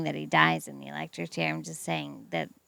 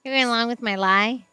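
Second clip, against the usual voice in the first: laughing; 4 semitones higher, 6 dB louder than usual. Real, not acted. Laughing at partner's confession.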